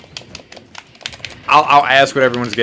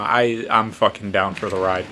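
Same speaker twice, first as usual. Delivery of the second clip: usual speaking voice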